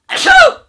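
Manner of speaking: sudden loud sneeze; a real, loud sneeze. Real, not acted. Vlogger sneezing mid-sentence, loud sneeze. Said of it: "That sneeze".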